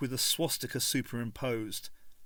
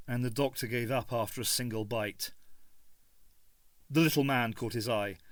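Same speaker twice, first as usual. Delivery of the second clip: ordinary talk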